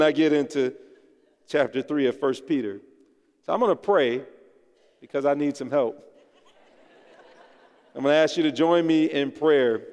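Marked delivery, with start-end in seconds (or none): none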